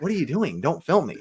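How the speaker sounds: high, hushed mock protest with swooping pitch; mimicking objecting bystanders. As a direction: lightly imitate people objecting to being filmed.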